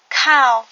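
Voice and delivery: Cow repeated loud and clear; Khmer word model. Shown as a demonstration. Teacher to learners, repeating Cow loudly. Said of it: "cow signifie pantalon".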